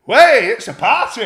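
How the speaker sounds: loud, high whooping shout; boisterous re-enacted cheer; mock party cheer. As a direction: holler it like greeting a party. Retold cheer at arriving police.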